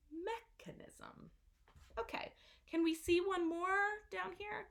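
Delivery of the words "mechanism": mechanism, first syllable stressed, soft; model for repeating. Shown as a demonstration. Teacher models mechanism for students to repeat. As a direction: say mechanism slowly for the class to echo. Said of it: "you can repeat after me".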